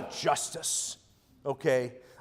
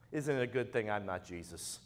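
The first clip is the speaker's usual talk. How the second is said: much softer, lower aside after the rant; quiet wry joke; dry, quiet punchline; 7 semitones lower, 13 dB quieter than usual. Real, not acted. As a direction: drop it low and dry.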